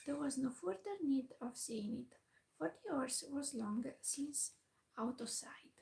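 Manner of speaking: ordinary delivery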